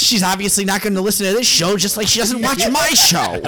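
high, mock-wounded author voice; George. Author complaining about a departed listener, high mock indignation. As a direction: play an affronted writer, rising in pitch. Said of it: "I'm George".

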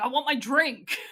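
loud, petulant demand for a drink; angry demand. Retold or imitated. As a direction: bark it out in mock anger. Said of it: "fucking angry".

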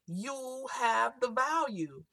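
sudden slight accent shift on "You have the value"; the accent slips in on "You have the value". Real, not acted. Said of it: "I don't know why my accent just changed then".

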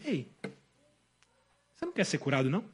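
low, soft, casual prodding question; Jesus' imagined intonation. Acted. Preacher voices Jesus close beside the paralytic, softly prodding. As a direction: lean in close and ask it quietly, offhand. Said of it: "e disse mais ou menos assim".

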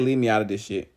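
a woman waving off the drama, low and quiet. Retold or imitated. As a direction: drop your voice low and wave it off. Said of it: "[name]'s like".

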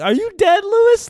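father mocking his son, very high and loud; father's taunt. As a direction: squeak it up like a jeering dad. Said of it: "That's my father's name".

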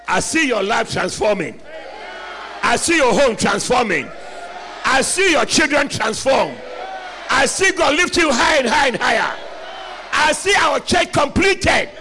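loud, driving prophetic declarations; rousing pulpit call-and-response; full-force preaching. Acted.

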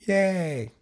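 high-pitched "yay", drawn out and falling; mock shout of joy at normal volume; demonstrated cheer. Shown as a demonstration.

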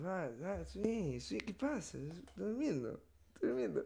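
soft, drowsy muttering; sleepy mumble. Retold or imitated. Son behind the door, mumbling softly as if asleep.

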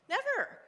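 "Never." shot up high and loud; a sudden high, loud "Never."; emphatic never. Real, not acted. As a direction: blurt "Never." with full force. Emphatic denial in her story.

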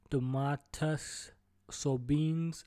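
slow, careful try at "Sabonis"; sounding out "Domantas Sabonis" haltingly; unsure how to say "Sabonis", so slow and careful; unsure pronunciation. Real, not acted. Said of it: "I don't know how to say his last name".

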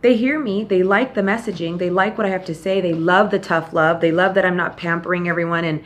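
ordinary talk

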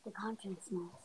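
hushed whisper. Acted. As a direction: breathe it almost silently. Whispered prompt in story.

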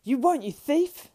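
high, slow, very loud outburst; furious panted accusation. Acted. Frodo glares at Sam in fear and enmity, furious shout. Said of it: "he panted".

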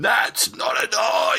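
high, slow, hammed-up drunk yell; drunken shout. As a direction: bellow it like a drunk gamer at the screen. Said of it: "screaming your drunken tits off".

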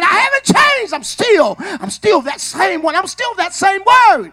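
loud, rising preacher's hoop voice; preaching hard. Acted. Preacher voicing God's message to the church, loud hooping preaching. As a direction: preach it out with full force, building.

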